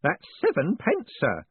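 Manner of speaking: put-on high, fussy old postman, proud of his takings; an eager old clerk showing off coins; old man proudly reporting to his boss; proud old Grote. A narrator's reading. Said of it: "he added proudly".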